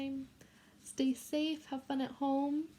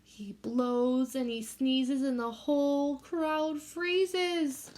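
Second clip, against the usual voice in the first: put-on reading a picture book to children; gentle read-aloud for a class; story reading; 3 semitones higher, 5 dB louder, much slower than usual. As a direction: read it like storytime for little kids.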